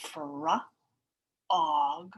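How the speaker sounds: slow, stretched syllable chunks fra and og; sounding out slowly. Shown as a demonstration. Teacher sounding out the chunks for the class, slow. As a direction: draw out each chunk before joining. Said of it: "slide that together".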